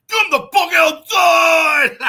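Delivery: loud, high, slow shouted threat; mock angry challenge. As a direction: bellow it like a parking-lot bully. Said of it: "And he's like".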